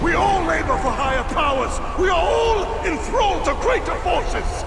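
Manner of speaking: loud, high, ranting defiance; villain raging at his end; defiant villain shout. Acted. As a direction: hurl it at your killer. Villain's defiant final speech.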